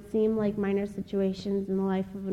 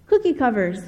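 mildly raised, louder imitated shout; retold yell; 3 semitones higher, 6 dB louder than usual. Kid heckling the speaker from the chapel's front row, retold yell. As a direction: mimic a kid yelling out, not full volume. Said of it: "he yelled out".

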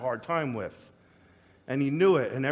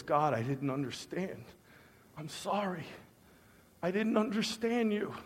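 soft, humbled confession; hushed apology; 8 dB quieter than usual. Retold or imitated. Man confessing to God he misunderstood, hushed apology. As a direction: say sorry quietly, with pauses between phrases.

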